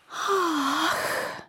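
high breathy ah of wonder; sigh. Acted. New ornaments sighing at the old one, awed. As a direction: sigh in awe. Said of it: "vzdychly nové ozdoby".

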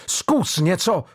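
mild narrated roar. A narrator's reading. Mario roaring at Jordan, a mild narrated threat. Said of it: "zařval Mario".

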